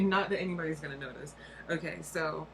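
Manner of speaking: usual speaking voice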